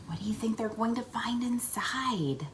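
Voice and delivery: hushed question whispered. Real, not acted. Secret question.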